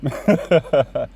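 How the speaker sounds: quick chuckle; brief amused laugh; short laugh. Real, not acted. Laughs at own remark.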